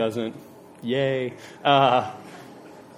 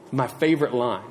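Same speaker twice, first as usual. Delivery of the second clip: ordinary delivery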